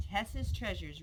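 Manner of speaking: usual speaking voice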